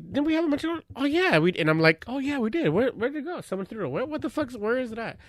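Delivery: high-pitched fake bewilderment; playing dumb. Guilty husband pretending to search for the corn, playing dumb. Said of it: "that's what I'm playing with".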